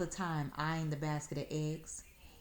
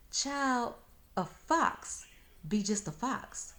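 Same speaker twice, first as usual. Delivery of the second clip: put-on slow, a touch higher, knowing old woman; lightly voiced patient grandma; grandmother voice; 7 semitones higher, slower than usual. Grandmother explains fox to child, slow and patient.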